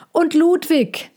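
high, slow excited call. A narrator's reading. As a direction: call the horse's name with delight. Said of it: "rief Theo".

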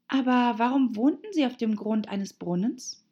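put-on high, questioning girl's voice; Alice. A narrator's reading. Alice figure asks puzzled question.

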